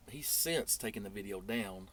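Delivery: ordinary delivery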